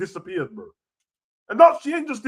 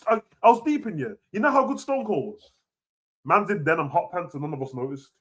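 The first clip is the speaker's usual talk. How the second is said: ordinary talk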